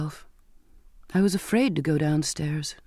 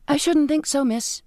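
higher timid maid; 9 semitones higher than usual. A narrator's reading. Nervous maid refusing.

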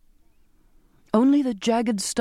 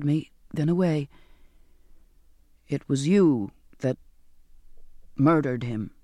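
reluctant; 4 semitones lower than usual. A narrator's reading. Sailor voiced lower in story.

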